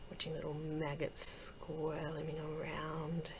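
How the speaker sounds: slow, soft relish; hushed fascination. Acted. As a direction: linger softly over a morbid delight. Savouring her fascination with decay.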